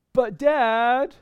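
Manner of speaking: put-on high whining "But Dad" like a child; whiny kid. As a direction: plead like a little kid. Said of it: "I don't mean to make fun of little kids".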